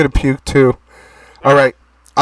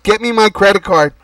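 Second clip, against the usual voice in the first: raised voice, higher; calling out; 8 semitones higher than usual. Acted. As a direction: call across the room. Calling out to someone offstage.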